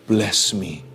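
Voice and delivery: low, soft humble plea. Acted. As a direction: ask humbly, bowing to God. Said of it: "dengan rendah hati dia berkata".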